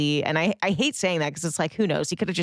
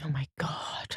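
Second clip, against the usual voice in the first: whispered reaction, much softer; hushed dread; 3 semitones lower, 10 dB quieter, slower than usual. Real, not acted. Listener reacting to a creepy party story, hushed dread. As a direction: whisper it under your breath, creeped out.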